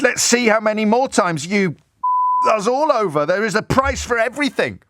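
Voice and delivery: high, sneering mock threat; mock menace. Host reading an MP's threatening text, sneering mock menace. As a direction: lay on his veiled threat.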